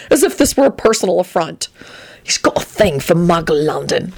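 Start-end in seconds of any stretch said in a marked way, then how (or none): none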